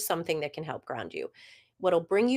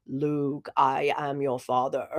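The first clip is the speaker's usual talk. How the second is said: Darth Vader voice; 4 semitones lower than usual. Retold or imitated. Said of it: "the iconic line from Darth Vader".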